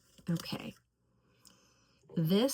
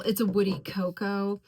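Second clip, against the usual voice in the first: usual speaking voice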